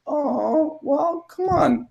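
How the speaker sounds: put-on tender to a child. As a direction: gently coax a sleepy boy along. Dad soothing son.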